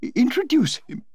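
the Duchess, alarmed at meeting a chiropodist, cries out; cried in alarm. Acted. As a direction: recoil at the idea in alarm. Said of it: "cried the Duchess".

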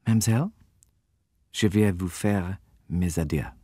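put-on smooth, native French accent; perfect French accent. A narrator's reading. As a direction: bid farewell in flawless French. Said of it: "in French ... with a perfect accent".

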